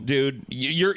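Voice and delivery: normal talking voice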